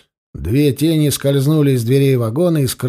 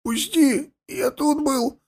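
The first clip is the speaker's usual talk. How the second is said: high, choked wheeze; wheezing; 11 semitones higher, 3 dB quieter, slower than usual. A narrator's reading. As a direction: gasp it out while being throttled. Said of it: "прохрипел Гвоздев". (прохрипеть, задыхаясь)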